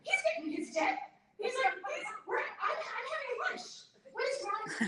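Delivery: the mom fussing that the waiter's fine, high and laughing; mom exasperated. Retold or imitated. Mom telling her daughter the waiter doesn't mind, high and laughing. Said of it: "She's like".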